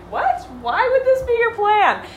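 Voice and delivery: high, loud mock disbelief; baffled. Miley reacting to their plan, high incredulous disbelief. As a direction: throw up your hands, incredulous. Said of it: "comes in as herself and is like".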